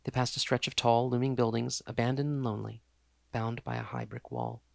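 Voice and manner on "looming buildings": ordinary delivery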